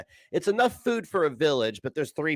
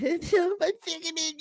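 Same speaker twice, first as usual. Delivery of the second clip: high-pitched imitation sobbing; mock boo-hooing; 14 semitones higher than usual. Mimicking her boo-hooing on stream, mock crying. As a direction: wail in a mocking fake cry. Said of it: "she was boo-hooing and".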